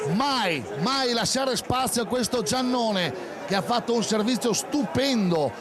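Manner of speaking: usual speaking voice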